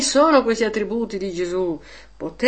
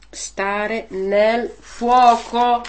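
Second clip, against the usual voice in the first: slow emphasis; much slower than usual. Real, not acted. Preacher's key answer.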